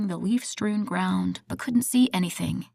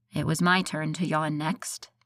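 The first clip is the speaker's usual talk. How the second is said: ordinary delivery, nothing marked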